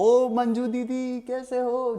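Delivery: high, slow, sing-song call down the lane; loud street greeting. Retold or imitated. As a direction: call out so the whole street hears. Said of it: "गली में रोज जोर से बोलता हूँ". (ज़ोर से खिंचा हुआ गली वाला बुलावा)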